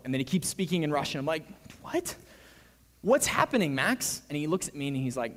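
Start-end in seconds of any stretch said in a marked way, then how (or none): 1.8-2.2 high-pitched, baffled yelp; startled high question. Retold or imitated. Teller baffled by unexplained Russian talk. 3.0-4.2 raised, louder, insistent question; acted-out rising alarm; alarmed demand. Demands answer as students keep him out.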